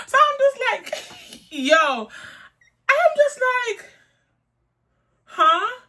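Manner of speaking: can't believe it, laughing; laughing disbelief. Real, not acted. Telling viewers how she reacted, laughing high disbelief.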